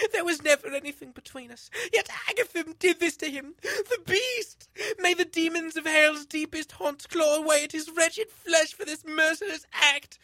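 high, loud sobbing curse. Acted. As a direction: choke the words out through sobs. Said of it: "chocking her voice with gasping sobs".

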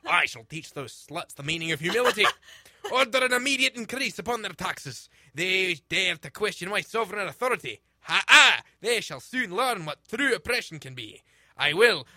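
loud cruel gloating noble; gloating villain. Acted. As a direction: sneer it out, laughing cruelly.

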